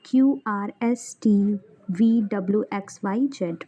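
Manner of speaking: normal talking voice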